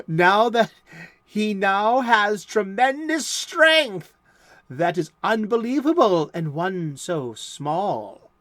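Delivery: loud, slow comic-book announcer voice; big old-time serial announcer; dramatic blurb read. Acted. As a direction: boom out the blurb like a golden-age radio narrator.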